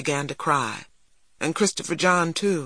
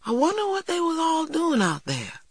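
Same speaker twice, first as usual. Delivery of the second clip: put-on very high, wondering child voice; puzzled boy; 13 semitones higher than usual. A narrator's reading. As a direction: wonder aloud in a high boyish voice.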